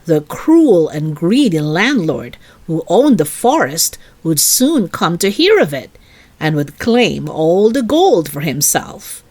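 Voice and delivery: plain reading voice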